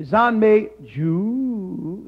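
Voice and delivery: "Ju" drawn out long in a swooping tone; slow "Zan Mei Ju", stretching and bending "Ju"; third tone. Shown as a demonstration. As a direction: teach the swooping tone on "Ju". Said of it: "It's the third, third tone".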